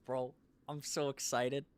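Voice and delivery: loud, beaming with excitement; excited. Real, not acted. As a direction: burst out grinning. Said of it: "you can hear the smile on my face".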